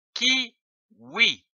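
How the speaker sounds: "kiwi" drawn out slowly as ki-wi; slow, syllable by syllable. Shown as a demonstration. Teacher blending syllables for pupils, sounding out slowly. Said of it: "L'association de ces deux syllabes".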